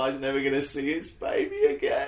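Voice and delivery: high-pitched, half-laughing lament; mock tearful. Retelling being moved by the trailer to friends, mock tearful. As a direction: wail over it, playing up the heartbreak. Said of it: "like, teared up".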